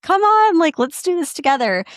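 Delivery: put-on rally the kids with a bright come on; excited invitation. To the older kids coming back, excitedly inviting them. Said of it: "And I'm like".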